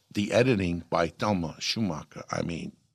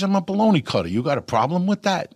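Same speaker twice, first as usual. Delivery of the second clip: indignant retort; 10 semitones higher, 5 dB louder, faster than usual. Retold or imitated. Repeats it defiantly to De Niro.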